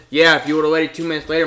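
ordinary talk

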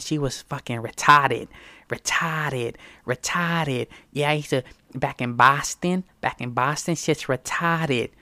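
imitating a pushed Boston accent, dropped r's. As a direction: put on a full-blown Boston accent. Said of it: "full-blown Boston accent".

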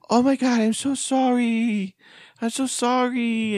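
put-on high, slow, over-apologetic Indian accent; a nervous young Indian woman apologizing, way up high; imitating an Indian accent, high and slow. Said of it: "She had the typical, like, Indian accent".

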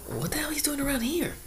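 high, startled squeal of disbelief; shocked squeal. Retold or imitated. Spotting the talkative coworker across the street, a high squeal of shock. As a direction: shriek it like you can't believe who's there.

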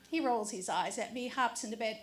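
usual speaking voice

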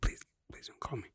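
a soft unvoiced whisper, half to himself; whispered aside. Taking back the hero offer, whispered plea. Joking aside after bragging.

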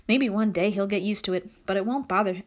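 plain reading voice

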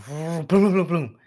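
loud, booming crash sound effect; imitated crashing noise. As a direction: boom it out like a trash can getting hit. Imitates noise of trash can crash.

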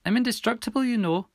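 put-on high, croaky girl voice; soft croak. A narrator's reading. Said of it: "croaked Milly, softly".

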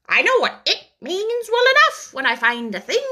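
high, blunt, matter-of-fact duck. A narrator's reading. The duck answers the mouse, high and blunt. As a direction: state it bluntly like a practical duck.

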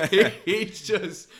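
slow, laughing through the words; comic laughing while praising the takedown. Real, not acted. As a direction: still laughing while telling.

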